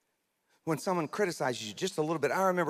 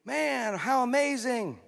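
high, bright mock praise, overly cheery; fake praise; 8 semitones higher than usual. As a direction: gush with hollow joy like you're hiding the pain. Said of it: "that's kinda fake".

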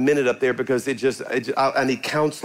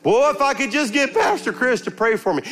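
higher, wishful plea; pleading churchgoer; 10 semitones higher than usual. Retold or imitated. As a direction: sigh it like a hopeful believer. Voicing a churchgoer.

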